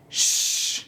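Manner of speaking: ش as a long hush with no voice; voiceless sh demo. Shown as a demonstration. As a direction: hush out the ش slowly. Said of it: "مثلاً بگیم".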